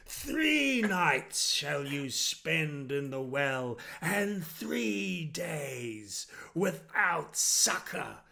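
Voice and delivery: slow, grand proclamation of a self-satisfied lord; pompous lord. Acted. Lord sentencing the trussed thief before the crowd, grandly. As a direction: declaim the sentence like a smug ruler.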